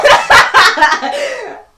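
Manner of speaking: loud laugh. Real, not acted. Surprised laugh at remark.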